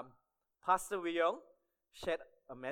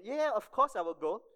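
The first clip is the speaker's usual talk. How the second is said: higher, louder mock-polite yes; politely agreeable retold reply; 6 semitones higher, 7 dB louder than usual. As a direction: imitate someone politely agreeing, a bit too brightly. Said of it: "he was being polite".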